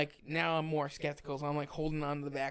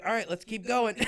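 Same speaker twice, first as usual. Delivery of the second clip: raised, higher instructor voice; louder mimicked go-ahead; imitated instructor call; 6 semitones higher, 6 dB louder than usual. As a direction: call it out like an instructor urging on trainees. Acts instructor calling out to climbers.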